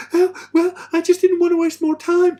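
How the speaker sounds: very loud, high-pitched wailing parody; hammed-up woman protesting in falsetto; mock upset woman. Imitating a woman teased over her chainsaw mishap, mock upset. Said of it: "You do that with a woman and she's like".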